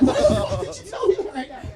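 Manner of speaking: very high, loud protest; outraged yelp. Retold or imitated. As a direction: shriek it in acted exasperation. Outraged reply to the prank.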